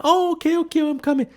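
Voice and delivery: high, sing-song mock mom reply; lightly voiced mom calling back; mother answering. Mom answering her son across the shop, high mock voice. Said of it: "And she went".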